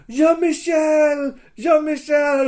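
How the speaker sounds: high, loud, drawn-out cry of a name; desperate calling out. Retold or imitated. Retelling a downed squire calling out, desperate high cry. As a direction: cry out for a comrade, hammed up. Retold comrade crying out when downed.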